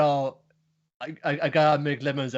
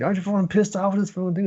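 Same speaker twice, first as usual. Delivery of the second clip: high, fast exasperated rant; mock rant; 8 semitones higher, much faster than usual. Thorfinn venting at the Vikings, fast and exasperated. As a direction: rattle it off fed up and high.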